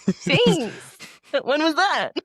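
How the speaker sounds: put-on high, loud old Southerner; hammed-up old-age Southern accent; old Southern self. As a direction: play an elderly Southern version of yourself. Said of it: "I gave my old self a, a Southern accent".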